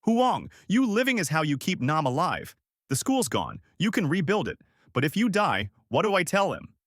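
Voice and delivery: high, urgent pleading; restrained desperate yell; mild yell. A narrator's reading.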